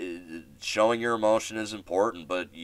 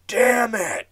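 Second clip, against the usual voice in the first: a sudden, much higher and louder yell of frustration; retold scream; 5 dB louder than usual. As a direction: blurt it out like a batter who just struck out. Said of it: "I just kinda screamed".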